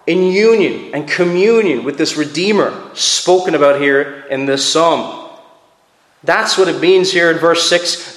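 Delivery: ordinary delivery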